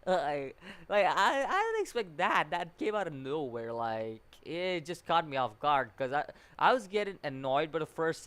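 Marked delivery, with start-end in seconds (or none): none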